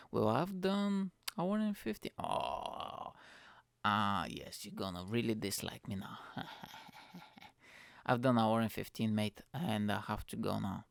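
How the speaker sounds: usual speaking voice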